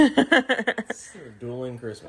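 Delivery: laughing. Real, not acted. Joking about the duo Christmas.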